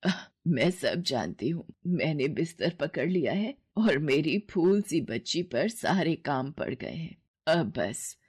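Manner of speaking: a tired mother resolving to get up; weary mother. Acted. Sick mother insists. (बीमार माँ की नीची, धीमी आवाज़)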